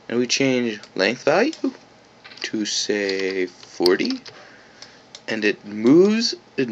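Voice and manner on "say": normal talking voice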